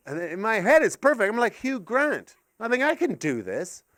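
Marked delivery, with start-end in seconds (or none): none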